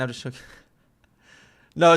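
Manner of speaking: ordinary delivery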